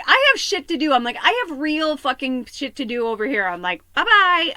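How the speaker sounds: loud, high shouted dismissal; fed-up shouting. Retold or imitated. Kicking her partner out, loud fed-up shout. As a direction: yell him out the door.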